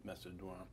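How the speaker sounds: Macedoine said softly the French way, ending "dwahn"; quiet, low French reading of Macedoine, "mass-eh-dwahn"; French pronunciation. Shown as a demonstration. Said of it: "That's a, the French pronunciation".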